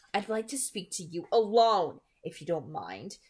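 a lightly voiced girl insisting low; insist, keeping it down; low insistence. A narrator's reading. Bella asking Edward to talk alone, low and insistent. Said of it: "she insisted in a low voice".

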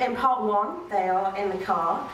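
ordinary talk

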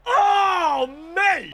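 high, loud drawn-out "oh"; excited yell. Real, not acted. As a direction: cry out in real disbelief. Celebrating a moment in the game.

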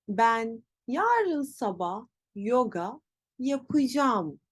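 slow, yapacağım as ya-pı-jam; spoken contraction. Shown as a demonstration. Teacher varies the example, yapacağım as yapıcam. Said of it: "Nasıl telaffuz edeceğiz?".

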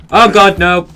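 loud, high cry of pretend horror; hammed-up scream of disgust; mock horrified shout. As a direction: recoil from the slime with a yell.

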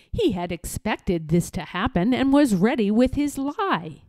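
usual speaking voice

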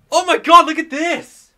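high, excited burst of wonder; amazed exclamation. Real, not acted. Player seeing a new ride appear, amazed excited cry. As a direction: burst out, thrilled by the sight.

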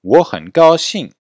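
loud, high, slow "Wo hen gao xing"; model for repetition. Shown as a demonstration. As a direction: project the Mandarin phrase for the class. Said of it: "Répétez". (modèle fort et lent à répéter)

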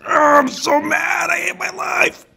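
very high, strained mock shout; mocking the angry player with a hammed-up strained squeal. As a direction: squeal like a sulking player in a tantrum. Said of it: "I just sound like I was taking a dump".

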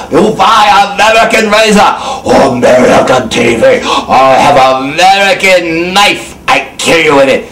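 broken-English foreign accent, hammed up; mock foreign accent. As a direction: play a menacing foreign merchant in a mock accent. Mock foreign seller threatening America.

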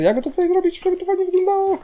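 playful, very high put-on voice; silly high voice. Player wondering how to build it nicely, silly high voice. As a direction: muse aloud way up in falsetto pitch.

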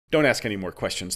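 plain reading voice

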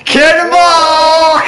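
long drawn out high yell; shouted call. Real, not acted. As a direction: bellow it slowly like a battle cry. Calling out a game attack.

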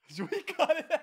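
high, loud laughing question. Real, not acted. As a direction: ask while laughing. Laughing at own joke, loud.

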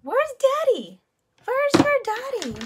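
high sing-song voice for a little one; baby talk. Acted. Talking to her baby.

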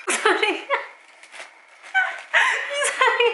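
high helium voice, laughing; giggling through helium. Real, not acted. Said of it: "이상한 거야".

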